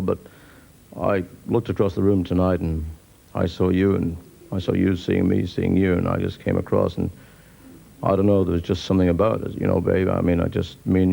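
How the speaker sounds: very low smooth chat-up croon; gravel-voiced lothario. Pub lothario chatting up a lone girl, very low croon. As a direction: purr the cheesy line down deep. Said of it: "he says".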